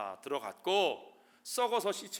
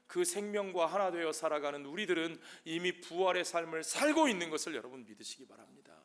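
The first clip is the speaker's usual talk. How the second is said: normal talking voice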